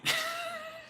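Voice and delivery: sudden high-pitched giggle; a real high burst of laughter; high squeaky laugh. Real, not acted. Cracks up at his own line.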